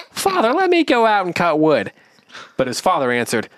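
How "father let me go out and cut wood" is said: high voice. Acted. Dullhead voiced by narrator.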